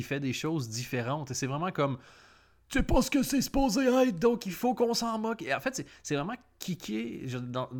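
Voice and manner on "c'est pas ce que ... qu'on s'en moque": high, loud sneering voice; mocking quote. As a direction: imitate the crowd scornfully, high and loud. Mocking the mockers' view.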